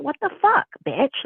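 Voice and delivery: imitating an outraged friend, high-pitched; mock outrage, pitch leaping up on the curse; retold going off. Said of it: "Like, she was just going off".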